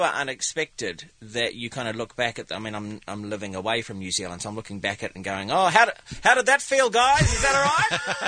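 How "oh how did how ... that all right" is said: high, loud, teasing fake sympathy; mock concern. Comedian teasing his losing countrymen, high mock concern. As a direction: gloat while pretending to care. Gloating at sulking teammates.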